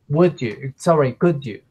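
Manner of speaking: ordinary talk, nothing marked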